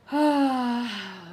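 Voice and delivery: long loud frustrated sigh; real worked-up sigh; heavy exasperated sigh. Real, not acted. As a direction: blow off the anger in one sigh. Exasperated after rant.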